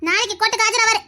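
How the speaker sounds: ordinary delivery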